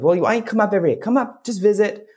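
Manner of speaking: high, coaxing storybook voice; wood carver. Acted. Wood carver invites Punchinello to visit daily, high coaxing voice. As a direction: invite him gently in a high voice. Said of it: "And the wood carver goes".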